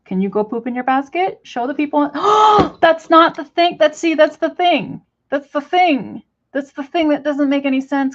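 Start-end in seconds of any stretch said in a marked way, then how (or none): 2.1-2.8 loud high shocked gasp; real startled intake of breath; sharp gasp. Real, not acted. Shocked at the mess. 2.8-8.1 higher and louder, fed-up telling off; real exasperation; exasperated scolding. Real, not acted. Scolding bird for pooping.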